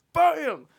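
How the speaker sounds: loud "bam" like a slamming door; imitated door bang. Storyteller startles listeners with a slamming door, imitated loud bang. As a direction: punch out the bang suddenly.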